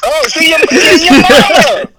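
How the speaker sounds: high, loud yo mama jab; yelling. Real, not acted. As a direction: shout it out in the roast.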